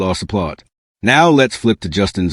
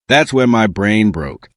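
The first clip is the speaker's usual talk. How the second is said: usual speaking voice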